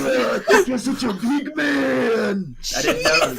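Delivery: high, slow sneering taunt; mocking taunt. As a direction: draw out the sneer. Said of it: "quoting own promo".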